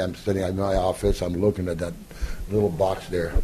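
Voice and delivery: normal talking voice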